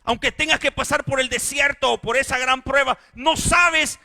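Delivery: loud, high, impassioned preaching; loud pulpit cry over the congregation; full-force preaching. Acted. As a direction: preach it with rising fire. Preaching build.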